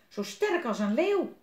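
put-on high, loud boyish exclamation; admiring little boy. A narrator's reading. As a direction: marvel at him in a high child voice. Frank admires Willem's strength. (bewonderend jongetje, hoog en luid)

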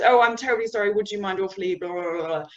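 over-polite mock apology that tails off into blahs; Hugh Grant. A Brit avoiding directness with a colleague, hammed-up apologetic dithering. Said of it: "we tend to do the Hugh Grant".

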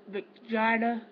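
normal talking voice

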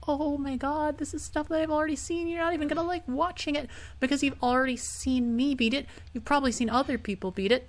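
high, hurried, whiny complaint; exasperated whine. Real, not acted. Player lamenting to viewers about replayed stuff, exasperated whine. Frets viewers will be bored.

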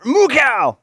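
high, very loud yell; alarmed shout. Real, not acted. Player calling after his cow, high shout. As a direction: shout for the cow at the top of your range.

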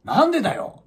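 imitating a complaint. Man at the clinic desk complaining loudly, mock angry. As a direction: act out the man's grumble. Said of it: "ちょっと大きな声で".

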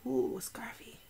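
whispered delight. Real, not acted. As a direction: breathe it out in awe. Admiring the scarf.

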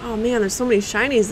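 usual speaking voice

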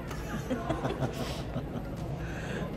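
breathy laugh. Real, not acted. Laughs at own joke.

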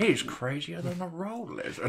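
Owen Wilson impression. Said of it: "you do a good Owen Wilson".

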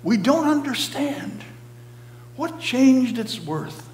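high, bewildered cry; amazed crowd asking. A narrator's reading. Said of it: "Some of them cried".

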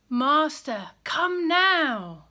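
urgent begging. Acted. Messenger begs again.

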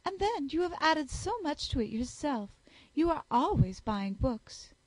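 high, affected voice of a flattering lady; fawning Miss Bingley. Acted. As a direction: flatter him shamelessly.

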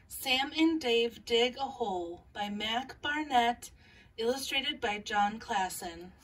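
gentle picture-book read-aloud with small character voices; calm storybook reading for young listeners; reading to children. Acted.

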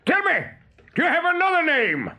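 high-pitched challenge; demanding cry. A narrator's reading. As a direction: call out, demanding an answer. Hook challenges the mysterious voice.

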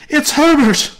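piercingly high, full scream. A narrator's reading. Mother screaming to husband that son has come, screamed. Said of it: "she screamed".

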